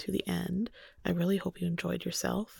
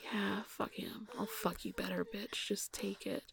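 grunted whisper; 10 dB quieter than usual. Acted. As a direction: growl it in a whisper.

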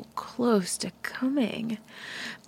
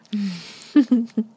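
breathy whispered laugh; 5 dB louder than usual. Acted. Teasing partner.

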